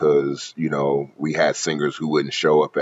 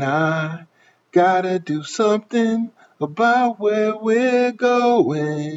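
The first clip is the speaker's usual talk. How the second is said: much higher, slow falsetto singer voice; J Pleasure voice; 14 semitones higher, much slower than usual. Shown as a demonstration. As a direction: sing it high like a [name] style singer. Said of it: "what I call J Pleasure".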